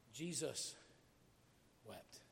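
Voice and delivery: low, soft, with a long pause between words; hushed reverence. Acted. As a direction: let the last word drop almost to nothing. Solemn quote of shortest verse.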